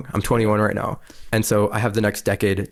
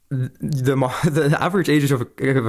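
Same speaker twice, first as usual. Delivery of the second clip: struggling to speak through real laughter; laughing; 4 semitones higher than usual. Real, not acted. Podcast guest citing Congress's average age, laughing. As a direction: try to get the words out while laughing. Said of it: "I can't even say that without laughing".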